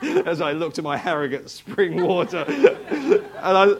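real laugh breaking into the line; amused. Real, not acted. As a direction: crack up while finishing the anecdote. Laughing at his own story.

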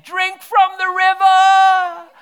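full-throated drawn-out cry; shout. Retold or imitated. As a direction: bellow it out across the church. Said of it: "and I shouted".

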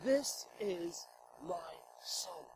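soft breathy whisper of a proud father; hushed intimate whisper. Shown as a demonstration. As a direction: breathe it to him as you circle. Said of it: "God circles around this person saying".

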